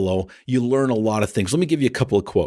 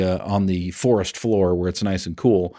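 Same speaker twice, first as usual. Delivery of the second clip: ordinary talk, nothing marked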